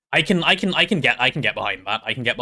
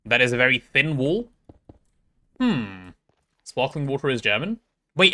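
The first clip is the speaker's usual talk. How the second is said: normal talking voice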